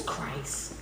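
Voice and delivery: whispered reverent name. Acted. Climax word breathed out.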